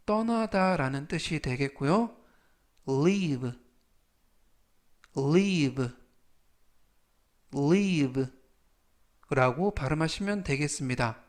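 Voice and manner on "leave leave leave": leave, said slowly three times for students to copy; leave를 천천히 세 번 또박또박; slow repeat-after-me. Shown as a demonstration. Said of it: "천천히 세 번씩 읽어드리고요". (따라 읽도록 천천히 세 번)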